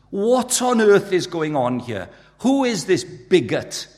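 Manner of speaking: loud, high, slow indignant objector; drawn-out mock outrage; acted outrage. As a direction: demand it in scandalised disbelief.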